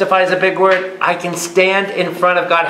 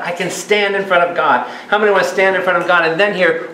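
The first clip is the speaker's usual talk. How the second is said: ordinary delivery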